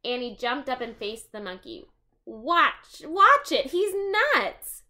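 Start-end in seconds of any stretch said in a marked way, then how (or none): 2.2-4.8 high, loud panicked warning, rising as it goes; alarmed. Acted. Jack warning Annie about the stick-holding monkey, loud alarmed cry. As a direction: blurt it out in a scare.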